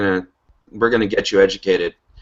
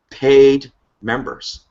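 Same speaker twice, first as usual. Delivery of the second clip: "paid" drawn out slowly and louder; stress on paid; 5 semitones higher, 4 dB louder, much slower than usual. Real, not acted. Host to team, insisting on "paid" slowly. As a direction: lean hard on "paid", slow it right down. Contrasting paid vs deciding members.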